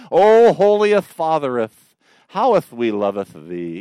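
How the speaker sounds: hammed-up old-English prayer voice, loud and slow; pompous mock-archaic prayer, stretched out; mock King James prayer. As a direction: parody a mother-in-law praying in thee-and-thou. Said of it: "I have to suppress my giggling when she does it because it's like".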